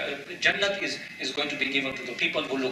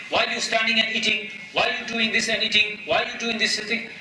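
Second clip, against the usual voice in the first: higher-pitched imitation of nagging questions; mock scolding; 7 semitones higher than usual. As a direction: imitate a parent nagging a child. Mimics parent's nagging questions.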